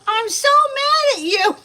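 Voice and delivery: high, loud mock tantrum shriek; hammed-up furious yell, very high-pitched; imitated angry yell. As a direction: mimic a child yelling in a temper. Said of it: "yells at me".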